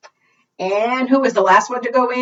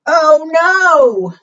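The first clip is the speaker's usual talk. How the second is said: high, loud "oh no"; dramatic cry of dismay for children; mock dismay; 7 semitones higher, 6 dB louder than usual. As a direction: exclaim it in storybook alarm. Ball went into the doghouse.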